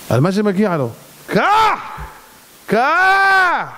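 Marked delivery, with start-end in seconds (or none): 1.3-3.7 a high, loud cry of the single word; sudden cry. Acted. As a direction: shout it out to shake the listeners. Preacher cries out answer. (צעקה חזקה וגבוהה)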